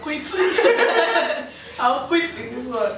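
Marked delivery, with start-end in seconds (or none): 0.3-1.8 loud burst of laughter. Real, not acted.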